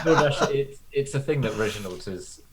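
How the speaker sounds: cracking up in character; laughing. Real, not acted.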